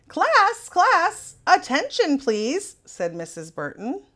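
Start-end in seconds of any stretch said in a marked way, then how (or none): 0.1-2.8 much higher, louder call for attention; calling class to order. A narrator's reading. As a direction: call over the noisy class.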